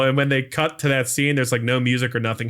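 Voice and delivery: ordinary delivery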